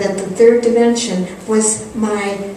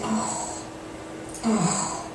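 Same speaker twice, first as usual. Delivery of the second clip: soft moved sighs; 13 dB quieter than usual. Retold or imitated. Mother starts crying.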